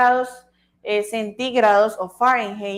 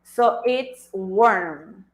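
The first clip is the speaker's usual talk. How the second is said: very slow, drawn-out it's warm; teacher slowly modelling warm; much slower than usual. Shown as a demonstration. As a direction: stretch it's warm for learners. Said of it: "teacher modelling warm slowly".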